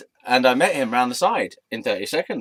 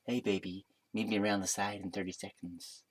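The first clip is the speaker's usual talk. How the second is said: much softer, low-key come-on; put-on seducer voice; 3 semitones lower, 11 dB quieter than usual. Bartender inviting the storyteller round the side, soft seductive voice. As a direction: murmur it like a mock seducer. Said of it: "I don't know what he sounded like".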